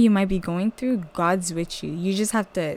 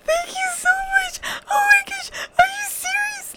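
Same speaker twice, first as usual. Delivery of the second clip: much higher, tearful sob of joy; crying on the phone. Retold or imitated. Thanking the bank caller for the job, crying with joy. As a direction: sob it out, overwhelmed with gratitude. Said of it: "the way I cried in the phone".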